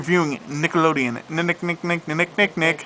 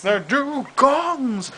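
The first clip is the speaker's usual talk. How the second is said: high, very slow Nigel voice; Nigel Thornberry impression; 9 semitones higher, much slower than usual. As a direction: stretch it out, high and silly. Echoing Nigel quote.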